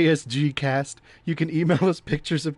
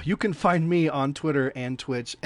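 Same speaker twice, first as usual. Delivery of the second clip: ordinary talk, nothing marked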